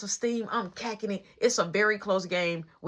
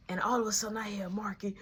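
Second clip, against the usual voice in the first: normal talking voice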